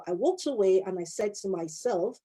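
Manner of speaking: ordinary delivery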